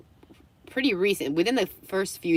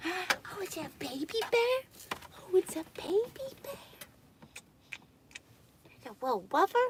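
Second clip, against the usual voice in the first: very high, soft cooing; 14 semitones higher, 8 dB quieter than usual. Real, not acted. Streamer fussing over the dog, high soft baby talk. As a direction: coo in a tiny voice to a pet.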